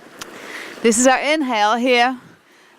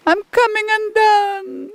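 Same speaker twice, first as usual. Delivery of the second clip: mock wail; 12 semitones higher than usual. Teacher jokes, voice cracks high.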